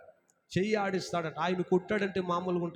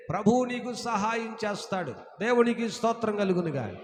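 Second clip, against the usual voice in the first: full-force preaching; 4 semitones higher than usual. Acted. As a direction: proclaim it from the pulpit. Rising exhortation, repeated phrases. (గట్టిగా బోధించే స్వరం)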